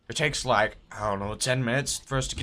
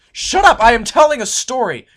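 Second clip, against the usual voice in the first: much higher, louder furious outburst; real angry shout; 8 semitones higher, 13 dB louder than usual. Real, not acted. Storyteller yelling at chat for interrupting, real angry shout. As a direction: tell them off for interrupting.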